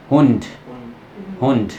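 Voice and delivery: हुंड ending in a breathy t-like d; real German pronunciation. Shown as a demonstration. Teacher models हुंड for students to copy. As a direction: let the end of हुंड escape on air. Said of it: "मेरे मुंह से सुनो एक बार पहले".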